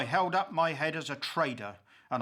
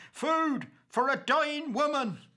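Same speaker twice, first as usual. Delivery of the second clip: crying out; 10 semitones higher than usual. A narrator's reading. Husband cries at the workhouse gate for food, desperate high cry. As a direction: call out for help at the gate.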